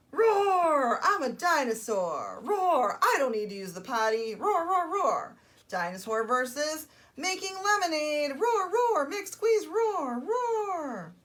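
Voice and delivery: high, loud, gleeful roaring; roaring toddler dinosaur. Acted. Storyteller plays a dinosaur who refuses the potty, loud and roaring. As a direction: roar it out like a boisterous little dinosaur. Reads dinosaur picture book aloud.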